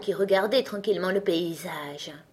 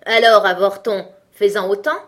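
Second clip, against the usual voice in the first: scornful giant; 9 dB louder than usual. Acted. Giant challenging tailor.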